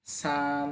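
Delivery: সান stretched and level; Chinese first tone. Shown as a demonstration. Teacher repeating সান with a long level tone. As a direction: keep সান long and flat. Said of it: "first tone টেনে বলতে হবে".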